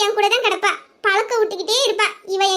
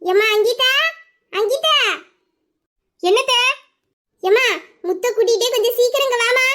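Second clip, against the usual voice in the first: summoning someone from another room, pitch climbing at the end; rising call; 4 semitones higher than usual. Acted. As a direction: call her name with a rising lilt. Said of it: "இதோ கூப்பிடுறேங்க".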